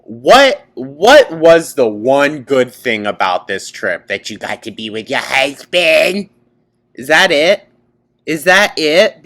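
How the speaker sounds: loud, high-pitched incredulous questioning; exasperated outburst. Real, not acted. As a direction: demand an answer in disbelief, voice climbing. Exasperated reaction to the clip.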